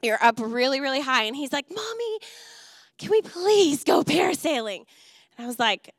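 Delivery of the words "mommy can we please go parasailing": put-on 1 child begging. Child voiced in story.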